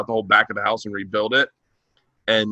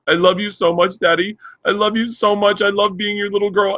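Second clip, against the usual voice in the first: higher-pitched imitated bawling daughter; tearful little girl; 8 semitones higher than usual. Daughter professing love to daddy, imitated tearful voice. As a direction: plead sweetly in a high child voice. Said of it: "she just looks at me bawling".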